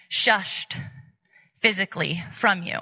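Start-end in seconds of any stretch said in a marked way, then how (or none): none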